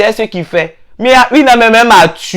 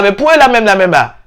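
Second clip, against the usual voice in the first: usual speaking voice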